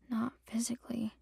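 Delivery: barely whispered admission. Acted. Trinity whispers her hurt to Juniper. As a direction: breathe it out, ashamed. Crying friend admits hurt quietly.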